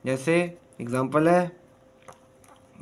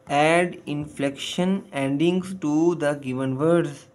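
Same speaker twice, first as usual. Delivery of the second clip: slow reading; much slower than usual. Shown as a demonstration. Teacher reads instruction slowly.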